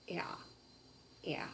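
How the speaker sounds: ordinary delivery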